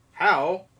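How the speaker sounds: usual speaking voice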